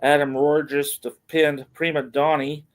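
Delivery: usual speaking voice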